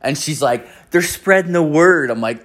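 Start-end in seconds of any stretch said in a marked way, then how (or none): 0.9-2.1 lightly voiced woman, pitched higher; neighbour's defence. Neighbour explaining why she let missionaries in, higher-pitched defence. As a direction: raise the voice to explain earnestly.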